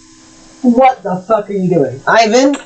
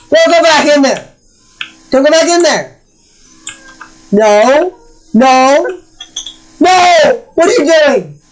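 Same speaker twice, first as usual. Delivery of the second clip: raised-pitch orders to a pet; exasperated scolding; 5 semitones higher than usual. Real, not acted. Owner to cat going back in the cabinet, exasperated scolding. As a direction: cry out to stop the cat, more insistent each time.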